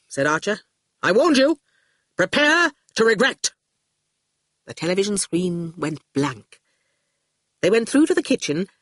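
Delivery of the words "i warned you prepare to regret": higher, deliberate warning; threat. A narrator's reading. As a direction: warn them ominously. Archer threatens them.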